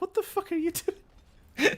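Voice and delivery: high-pitched, drawn-out mock disbelief, breaking into laughter; baffled onlooker. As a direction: imitate a bewildered friend in a high, slow voice. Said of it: "you were just looking at me like".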